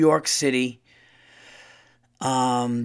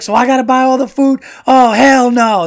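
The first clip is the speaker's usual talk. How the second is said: high, loud mock outrage; imitating the dad; 10 semitones higher, 12 dB louder than usual. Dad refusing to pay for all the food, high loud mock outrage. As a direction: huff it like a cheap dad refusing. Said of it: "He's like".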